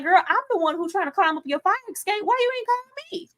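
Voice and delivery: high-pitched, put-on flirty come-on; Judith. Judith propositions Maddie about the fire escape, in a high mock voice. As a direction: pitch it way up, as a forward woman propositioning her friend. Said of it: "Judith is just like".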